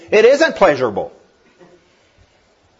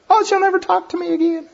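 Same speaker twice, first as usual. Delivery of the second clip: high, strained mock anguish; mock despair. Father after the first spanking, in high mock anguish. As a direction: squeak it out like a heartbroken dad. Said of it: "I died".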